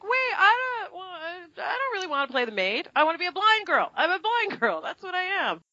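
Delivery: put-on high, eager girlish insistence; mock pleading. Young actress pleading with her castmates, high and eager. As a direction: gush it out like a kid begging for a role.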